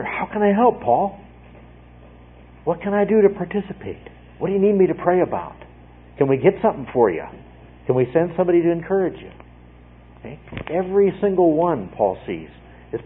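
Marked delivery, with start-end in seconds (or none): 0.0-9.3 a higher, faster voice of eager helpers; eager church members. Retold or imitated. Preacher voices believers asking Paul how to help, eagerly higher and faster. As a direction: voice the congregation eagerly offering help.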